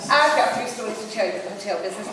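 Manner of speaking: ordinary talk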